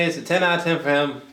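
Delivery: normal talking voice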